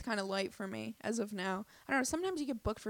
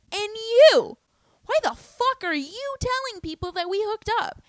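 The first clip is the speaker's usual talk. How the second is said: much higher and louder, an outraged yell; furious retold outburst; 12 semitones higher, 11 dB louder than usual. Turning on the comic who spread the hookup rumor, an outraged yell. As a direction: explode at him like you've been betrayed.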